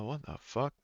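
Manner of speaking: ordinary talk, nothing marked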